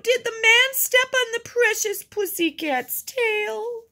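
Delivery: put-on very high baby talk to a cat; syrupy high-pitched pet voice; cooing. A narrator's reading. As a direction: coo over an injured pet. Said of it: "she cooed".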